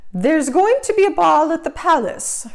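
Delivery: high, loud stepmother exclamation; stepmother exclaiming. A narrator's reading. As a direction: announce it like a haughty stepmother. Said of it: "her stepmother exclaimed".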